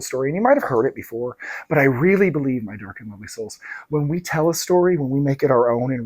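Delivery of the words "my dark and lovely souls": low and soft, drawing listeners close; hushed intimate greeting. Acted. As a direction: murmur it like a secret. Signature greeting repeated.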